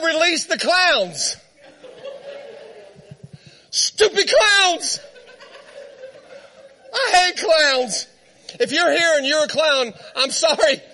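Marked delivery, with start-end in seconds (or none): 3.7-8.1 slow, higher scornful drawl; disgusted. Real, not acted. Preacher scorning the clowns, slow and disgusted. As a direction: sneer the words slowly.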